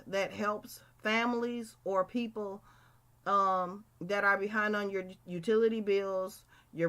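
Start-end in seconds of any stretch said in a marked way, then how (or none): none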